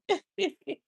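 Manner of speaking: short, high, soft laugh; quick soft giggle. Real, not acted. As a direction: let out a little high laugh. Laughs after speaking to someone.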